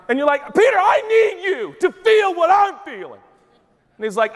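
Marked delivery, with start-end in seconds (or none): 0.5-3.2 very high, strained wail; mock-distraught high-pitched begging; desperate plea. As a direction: cry out for sympathy, hammed up. Acted desperate plea to calm Peter.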